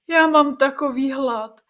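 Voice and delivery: slow, loud, tormented moan; hungry wail. Acted. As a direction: wail it out, starving. Creature wailing with hunger.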